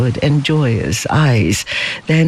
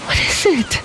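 quavering; 11 semitones higher, faster than usual. A narrator's reading. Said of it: "quavered Lei Chu".

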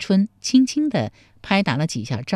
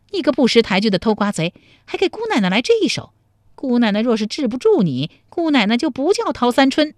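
put-on higher-pitched angry young woman; through gritted teeth; 6 semitones higher, faster than usual. A narrator's reading. Tao Sanchun twisting the melon thief's ear, furious scolding. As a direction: scold fast and fierce, teeth clenched. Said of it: "咬牙切齿地说".

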